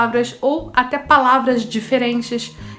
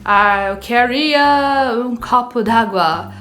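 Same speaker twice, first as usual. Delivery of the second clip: put-on slow, louder American accent in Portuguese, heavy r in "queria"; 3 dB louder, slower than usual. Teacher mimics an American asking for water, American accent. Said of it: "Americano falando português vai falar com aquele jeito americanizado".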